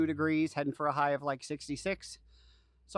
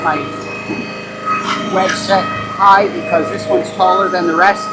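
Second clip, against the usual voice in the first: shouting over machinery; 2 semitones higher, 15 dB louder than usual. Real, not acted. Talking over the running log splitter.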